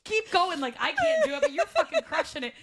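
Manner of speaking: high, laughing cheer-on; squeaky giggling retold plea; retold laughing encouragement. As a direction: egg her on through helpless laughter. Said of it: "I'm, like, fully".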